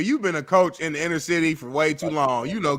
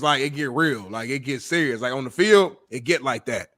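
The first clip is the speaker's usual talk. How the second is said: ordinary talk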